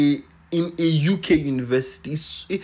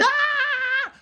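high-pitched excited scream of joy; 3 dB louder than usual. Retold or imitated. Reading the offer, screaming with joy. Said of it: "That's how loud I was screaming".

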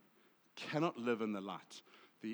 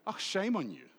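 higher mock scolding, falling at the end; shaming reproach; 6 semitones higher than usual. Shown as a demonstration. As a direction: demonstrate putting shame on someone. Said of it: "we put shame on people".

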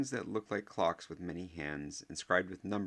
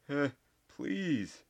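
put-on higher, bright cheerful old wizard; 9 semitones higher, 3 dB louder than usual. A narrator's reading. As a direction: wave it off cheerfully. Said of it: "said Dumbledore cheerfully".

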